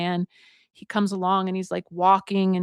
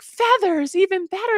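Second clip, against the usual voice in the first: high-pitched storybook delight; 12 semitones higher, 3 dB louder than usual. Retold or imitated. Couple hearing the old woman's idea, delighted. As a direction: act thrilled at a brilliant idea.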